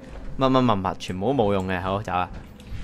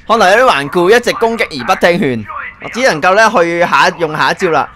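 theatrical villain voice; 5 semitones higher, 4 dB louder than usual. Acted. Dubbing the villain's game lines.

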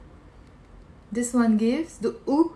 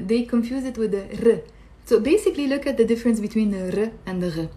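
ordinary talk

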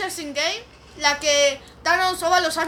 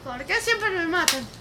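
ordinary delivery

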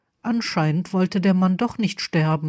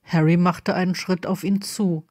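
plain reading voice

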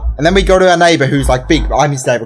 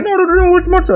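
high, frantic denial; hammed-up high protest; panicked Jacob; 12 semitones higher, faster than usual. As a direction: insist in a squeaky panic.

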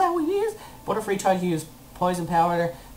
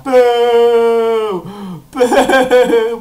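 loud, long mock jeer; heckler's drawn-out boo; playful booing; 3 semitones higher, 9 dB louder than usual. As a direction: boo it heartily, then laugh. Jeers at the Venonat.

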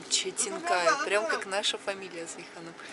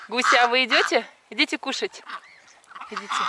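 louder, higher call to come eat; 3 semitones higher, 6 dB louder, faster than usual. Real, not acted. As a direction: call the geese over to eat. Calling birds to eat.

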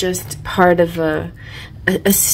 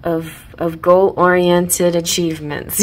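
ordinary delivery

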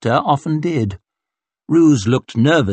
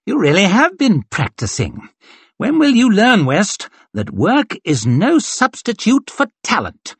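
taunting swordsman; 9 semitones higher than usual. Acted. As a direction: needle your opponent with scorn. Glocta taunting West mid duel.

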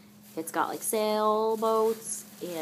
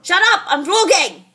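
high, loud yell; shouting; 8 semitones higher, 9 dB louder than usual. Real, not acted. Vlogger silencing someone talking in the background, shouting. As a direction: yell over the interruption. Yelling at interrupting person off camera.

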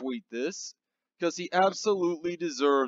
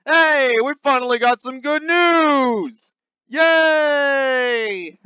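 higher, louder, bright cheer; excited cheer; 9 semitones higher, 8 dB louder, much slower than usual. Real, not acted. Host cheers finally getting good news, higher and louder.

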